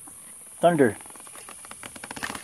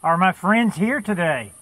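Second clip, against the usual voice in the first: raised, lilting call into the woods; 4 semitones higher than usual. Real, not acted. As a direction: call out gently to someone unseen, pitched up. Calling out to unseen woodland friends.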